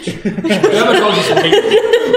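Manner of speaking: shrieking with laughter, slow; high squealing laugh. Pretend. Mock clickbait ad, laughing.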